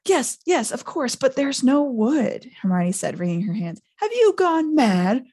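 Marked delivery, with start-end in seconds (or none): 0.1-2.5 higher, slow, flustered Hermione. A narrator's reading. 4.0-5.2 higher, louder, slow furious bellow. A narrator's reading. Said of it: "Ron bellowed".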